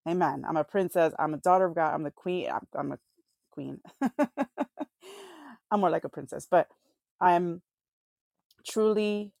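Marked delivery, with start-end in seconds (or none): 0.1-3.8 excited self-talk. Retold or imitated. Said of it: "I'm like". 3.8-5.7 big high-pitched laugh. Real, not acted. Laughs at own joke.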